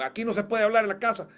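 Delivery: loud, high and quick scolding; scolding parent. Retold or imitated. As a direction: snap it out like a strict parent laying down the house rule. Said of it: "los papás siempre le han dicho".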